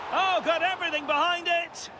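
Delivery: high-pitched commentator's exclamation; excited shot reaction. Real, not acted.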